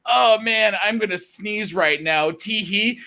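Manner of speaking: high, silly social-media voice; mock giddy poster. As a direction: chirp a trivial post, hammed up. Mocks a trivial social post.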